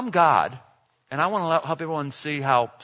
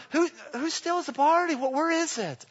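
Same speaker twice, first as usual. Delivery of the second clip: high, softer mock-flustered voice; panicky weakling; 9 semitones higher, 4 dB quieter than usual. Preacher mimicking disciples who ran off, high mock panic. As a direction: squeak it out like a rattled coward.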